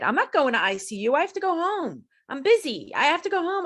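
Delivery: high-pitched insistent retold refusal; indignant protest. Patient sitting up in bed refusing ICU, indignant protest. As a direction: protest it urgently, pitch jumping up.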